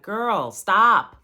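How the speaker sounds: loud, high cut-in; exasperated retold scolding. Friend interrupting her crying, exasperated scolding. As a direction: imitate a friend loudly calling a halt.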